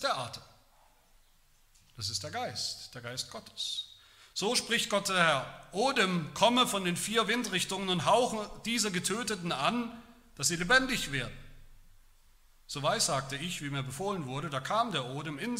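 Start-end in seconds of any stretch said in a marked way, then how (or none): 4.3-11.4 proclaiming. Acted. Preacher proclaims God's word.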